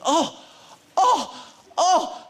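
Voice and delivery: put-on high, loud excited gasps of an old fan; excited old man. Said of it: "he starts getting very excited".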